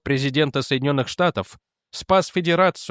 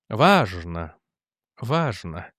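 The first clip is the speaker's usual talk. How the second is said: tasting the word; 6 semitones lower, much slower than usual. A narrator's reading. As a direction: try the word out to yourself. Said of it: "как будто пробуя слово на вкус". (задумчиво, будто пробуя слово на вкус)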